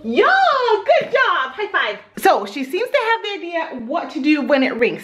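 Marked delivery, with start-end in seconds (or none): 0.0-2.0 high, slow, gushing praise; excited praise. Real, not acted. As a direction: cheer her on brightly. Praising toddler on potty.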